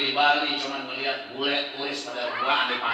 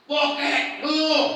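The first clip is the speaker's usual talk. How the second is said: high, loud preacher's punchline; fiery sermon climax; preaching at full force; 8 dB louder than usual. Acted. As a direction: drive it home to the congregation. Preaching burst.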